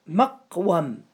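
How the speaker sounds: مقوم split slowly into "maq... wam"; drawn-out "maq-wam", a in both syllables of مقوم; sounded-out word pattern. Shown as a demonstration. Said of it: "قوم على مفعل". (مقوم مقطّعة ببطء على وزن مفعل: مَقْـ وَم)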